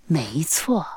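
whisper. Acted. Secret gift confirmed softly.

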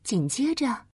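gentle bedtime story narration; fireside storytelling. A narrator's reading. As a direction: read it like a cozy bedtime tale. (温馨的睡前故事讲述)

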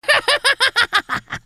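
high gleeful cackle; evil triumphant cackle. Acted. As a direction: cackle like a villain who won. Magician gets lamp.

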